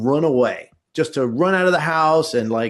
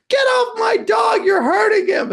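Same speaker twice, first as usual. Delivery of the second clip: put-on high kid shout. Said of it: "I'm like".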